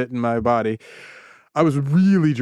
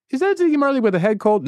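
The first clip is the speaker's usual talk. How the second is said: incredulous; 9 semitones higher, faster than usual. Retold or imitated. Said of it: "no one would be like".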